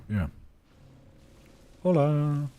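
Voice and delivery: ordinary delivery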